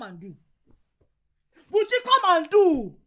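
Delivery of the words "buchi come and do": high, loud taunting call to come; loud mocking call. Wife shouting to husband to come and do. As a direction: yell it at him, daring him.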